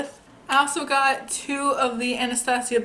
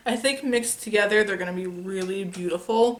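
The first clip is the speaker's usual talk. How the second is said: normal talking voice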